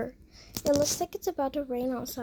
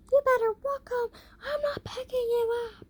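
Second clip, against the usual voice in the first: mom yelling; 10 semitones higher than usual. Acted. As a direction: shout it down like a fed-up mom refusing a ride. Mom refuses, yelling at kid.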